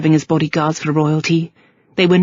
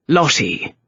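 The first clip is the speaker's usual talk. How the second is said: cold; 3 semitones lower than usual. A narrator's reading. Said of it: "The cold voice of sanity".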